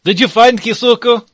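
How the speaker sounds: high, loud shouted question; calling back. Acted. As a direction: holler the question from another room.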